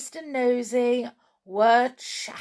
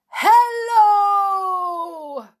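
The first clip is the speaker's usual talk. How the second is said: high, loud booming hello; booming giant hello; 11 semitones higher, 9 dB louder than usual. Acted. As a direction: boom it out like a giant. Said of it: "boomed the giant in his quietest voice".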